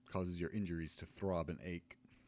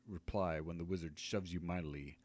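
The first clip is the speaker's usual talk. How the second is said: ordinary delivery, nothing marked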